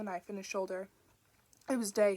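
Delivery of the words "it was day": a touch of breathless gasp, a little louder; wounded man pushing the words out; mild gasp. A narrator's reading. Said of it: "he gasped".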